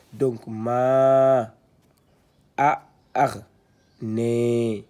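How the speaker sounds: syllabes détachées, fortes et tenues; épeler en récitant fort; lecture syllabée. Shown as a demonstration. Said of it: "Donc, je répète". (« ma, a, ar, ne » lu syllabe par syllabe, très fort)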